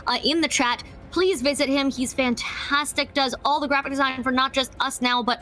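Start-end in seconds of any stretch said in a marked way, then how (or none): none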